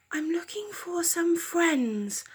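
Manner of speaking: high, soft owl voice; shy little owl asking. Acted. Owl telling the cats his wish, high and soft. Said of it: "Owl said".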